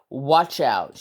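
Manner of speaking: ordinary delivery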